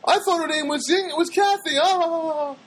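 high-pitched mimicked woman; imitated lady. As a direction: push the voice way up as the gushing lady. Acts out the lady in the store.

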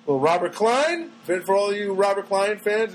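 ordinary delivery

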